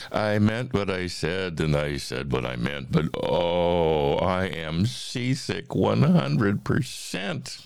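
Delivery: animated rhyming storybook reading; story narration. A narrator's reading. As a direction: read the verse with big, playful swings. Reads a rhyming story aloud.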